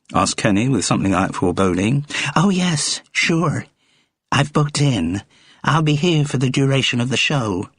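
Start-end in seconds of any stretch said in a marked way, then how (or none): 2.4-7.7 high, eager American woman; bright-eyed superfan, pitched well up; American fan Gloria. Acted. Gloria tells Kenny she is staying for the whole show, high and eager.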